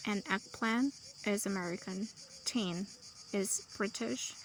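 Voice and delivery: ordinary delivery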